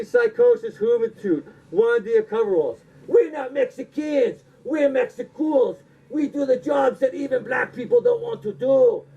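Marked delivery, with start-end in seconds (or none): 3.1-9.0 wrestling promo. Retold or imitated. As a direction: cut a brash tag-team promo. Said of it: "he cuts, like, one of the most legendary promos".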